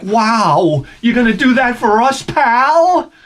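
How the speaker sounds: high mock-amazed sarcasm; sarcastic. Mocking the salesman's fake discount, high and sarcastic. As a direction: gush with fake wonder.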